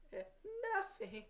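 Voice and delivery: acted weepy reply; sobbing. A narrator's reading. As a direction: sob it out like a crying little boy. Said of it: "sobbed Jimmy".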